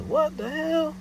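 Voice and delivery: very high exclaimed disbelief; retelling his shocked reaction; incredulous. Retold or imitated. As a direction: squeal it in disbelief.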